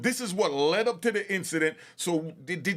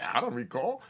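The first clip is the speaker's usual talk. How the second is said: mock witness; 8 semitones lower than usual. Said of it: "That's all he had to say".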